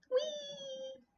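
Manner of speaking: very high tiny squeak; squeaky toy voice. Pretend. Cute squeaky toy noises while decorating.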